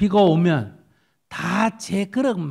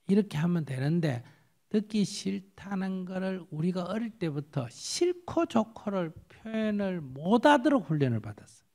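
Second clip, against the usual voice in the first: usual speaking voice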